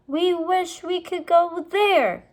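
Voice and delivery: put-on girl making a wish; Annie wishing. A narrator's reading. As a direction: say the magic wish. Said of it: "Annie said".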